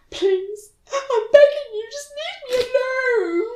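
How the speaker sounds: very high, wailing plea; mock tearful begging. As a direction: beg it in a squeaky pretend sob. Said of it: "I just burst into tears at that point".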